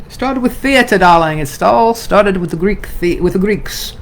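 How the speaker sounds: put-on grand British luvvie accent, darling; playful faux-British thespian voice; mock theatrical British. Hams up theater line as luvvie.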